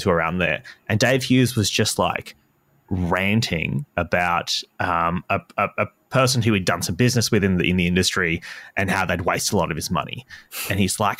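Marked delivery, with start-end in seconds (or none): none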